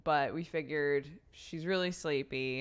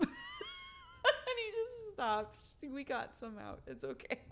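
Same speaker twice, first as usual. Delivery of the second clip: high cracking laughing plea; laugh-cry as you beg honey to stop; mock tearful husband begging, half laughing; laughing through tears; 5 dB quieter than usual. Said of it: "he was like".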